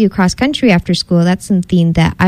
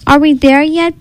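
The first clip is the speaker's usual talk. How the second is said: higher, whiny storybook groan; groaning complaint; 8 semitones higher than usual. A narrator's reading. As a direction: groan like a weary little cub. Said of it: "Bee Bear groaned".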